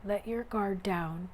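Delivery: ordinary delivery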